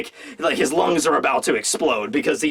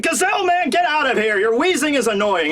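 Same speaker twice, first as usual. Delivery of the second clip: much higher, irritated dismissal; annoyed boss; 7 semitones higher than usual. Retold or imitated. Hold'em throwing the wheezing Gazelle Man out, higher and annoyed. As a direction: kick him out impatiently. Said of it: "it's like".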